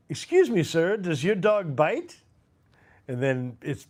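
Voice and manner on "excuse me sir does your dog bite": Peter Sellers. Acted. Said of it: "that's Peter Sellers".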